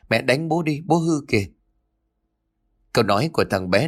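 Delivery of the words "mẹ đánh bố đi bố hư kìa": put-on high little-boy voice; childish tattling. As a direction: tell on dad like a small kid.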